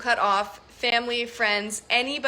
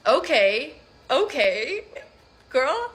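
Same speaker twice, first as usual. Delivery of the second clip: rising, higher-pitched skeptical okays; sarcastic dismissal; 3 semitones higher, 3 dB louder, slower than usual. Real, not acted. Woman answering Facebook posts about unfriending voters, sarcastic dismissal.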